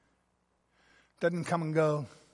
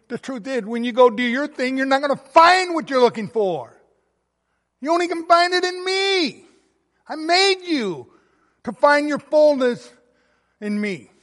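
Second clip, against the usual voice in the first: much higher and louder, pleading preacher voice; impassioned preaching; 8 semitones higher, 8 dB louder than usual. Acted. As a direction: plead as if speaking for the Lord. Voicing Jesus to listeners.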